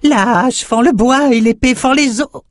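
high, delighted little creature; gleeful imp voice. A narrator's reading. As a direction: squeal it out with glee. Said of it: "Ravi, Crayeux applaudit".

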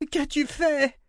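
high, strained roar of the queen. A narrator's reading. Queen roaring at the girl in the dark. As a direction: scream it at the dark. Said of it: "répéta Violette".

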